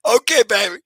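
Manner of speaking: high, loud burst of delighted laughter; thrilled someone showed up, laughing through it; excited laughing. Real, not acted. Delighted by a viewer joining.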